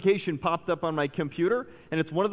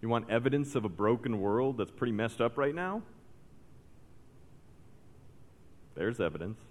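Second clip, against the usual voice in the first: hushed sermon; 8 semitones lower, 10 dB quieter than usual. Acted. Preacher warning church members, quiet and grave. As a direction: drop it down and let the warning sink in.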